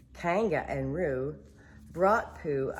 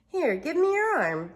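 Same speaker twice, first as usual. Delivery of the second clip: put-on boy voice; 11 semitones higher, 6 dB louder than usual. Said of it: "Christopher Robin said".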